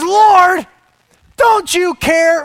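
loud, high exasperated yell; yelling at God. Acted. Martha yells at Jesus over the chores, loud and high. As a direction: scream it out in frustration. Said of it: "She says".

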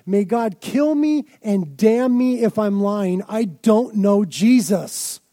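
loud, slow, heavy oath; forceful, slow oath of denial; heated oath. Retold or imitated. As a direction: swear it out like a man caught lying. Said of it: "the same as you and I saying".

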